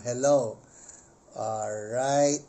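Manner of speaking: ordinary talk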